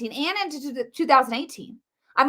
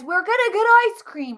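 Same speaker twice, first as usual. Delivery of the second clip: mock crowd; 9 semitones higher, 5 dB louder than usual. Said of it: "everybody says".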